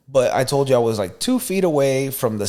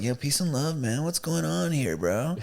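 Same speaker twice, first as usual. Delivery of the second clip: lightly voiced laid-back stoner, softer; the dog as a mellow Rasta, quiet and easy; chill Rasta dog; 8 dB quieter than usual. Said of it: "Just like".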